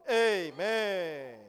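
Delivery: loud Amen stretched long and slow, falling at the end; drawn-out loud amen. Real, not acted. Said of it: "你们操练得太好了". (缓慢拉长、响亮地喊"嗯，Amen"，尾音下落)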